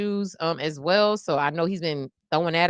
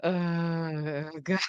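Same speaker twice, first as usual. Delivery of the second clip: normal talking voice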